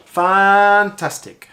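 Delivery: "fantastic" loud, high, with a long first syllable; exaggerated stretched emphasis. Shown as a demonstration. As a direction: hold the "fan" of "fantastic", loudly. Voiceover warm-up, exaggerating a word.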